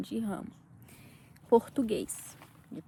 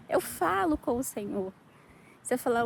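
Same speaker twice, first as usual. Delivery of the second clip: ordinary talk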